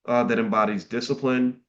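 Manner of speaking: ordinary talk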